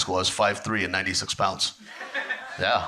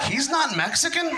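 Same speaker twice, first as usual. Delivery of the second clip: high, puzzled mock question; confused audience member. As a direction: play the confused half of the crowd. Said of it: "the other half's like".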